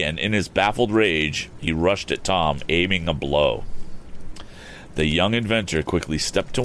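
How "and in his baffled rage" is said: ordinary delivery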